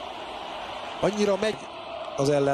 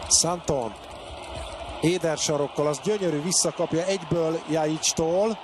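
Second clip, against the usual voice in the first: ordinary delivery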